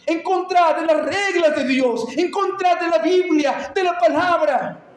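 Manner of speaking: impassioned, insistent exhortation; full-force preaching. Real, not acted. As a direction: press the congregation with rhetorical force. Preaches to the congregation.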